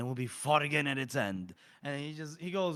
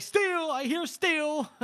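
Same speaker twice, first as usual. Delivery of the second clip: sleepy man bolting up yelling; startled yell; 13 semitones higher, slower than usual. Retold or imitated. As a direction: jolt awake shouting. Sleeping character startled awake.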